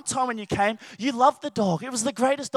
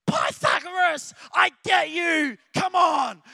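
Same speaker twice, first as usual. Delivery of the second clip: triumphant shout; 4 semitones higher, slower than usual. Retold or imitated. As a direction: shout like you finally cracked it. Finally understanding math.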